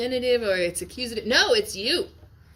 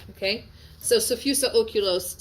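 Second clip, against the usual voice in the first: usual speaking voice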